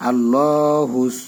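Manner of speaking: আল্লাহুস with thick full L, drawn slowly; slow, full-mouthed আল্লাহুস; heavy Allahus. Shown as a demonstration. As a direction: say আল্লাহুস heavy, never thin. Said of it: "এটা একটু মোটা করে বলবেন".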